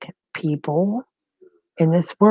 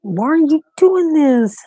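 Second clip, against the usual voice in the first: higher, louder and slow, near tears; anguished wail; 9 semitones higher, 6 dB louder than usual. Real, not acted. Grandmother pleading with her daughter who won't answer, anguished. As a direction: cry out at the silent phone. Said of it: "I start freaking panicking".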